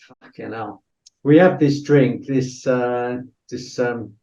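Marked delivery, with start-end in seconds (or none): 0.1-0.8 very soft, under the breath; hushed disbelief. Retold or imitated. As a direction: quietly swear to yourself in retold amazement. Retold amazed reaction over beer.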